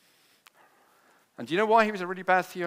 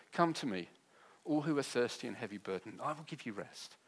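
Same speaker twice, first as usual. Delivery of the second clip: gentle quote; 3 semitones lower, 7 dB quieter than usual. Acted. Quoting Jesus softly.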